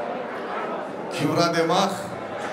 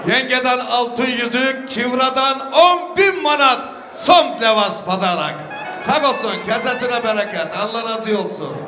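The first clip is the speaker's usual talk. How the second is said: booming wedding announcer; 5 semitones higher than usual. Real, not acted. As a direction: proclaim each gift to the hall. Host announcing gifts to guests. (düğünde hediyeleri gür sesle ilan eden sunucu)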